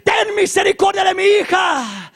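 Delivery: high, loud cry for mercy; shouted plea. Acted. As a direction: cry out as a desperate mother. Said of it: "y le gritaba".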